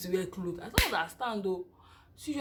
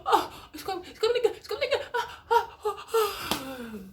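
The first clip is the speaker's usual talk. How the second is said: laughing panic; 10 semitones higher than usual. Retold or imitated. In labor, announcing another contraction to the room, laughing in panic. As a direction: panic and laugh as the pain returns.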